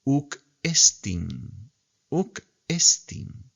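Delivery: uk estin drawn out slowly, two separate words; teacher models the phrase slowly; slow Greek reading. Shown as a demonstration. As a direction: read uk estin very slowly, word by word. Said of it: "Vemos la que sigue".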